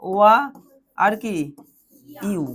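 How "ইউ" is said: ordinary delivery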